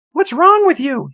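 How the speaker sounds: high, loud bratty sister voice; older sister. A narrator's reading. Sister needling her moping brother, high and loud. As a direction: snap at him like a bossy big sister. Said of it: "his sister asked".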